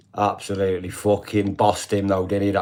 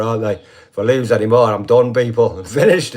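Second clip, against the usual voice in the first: normal talking voice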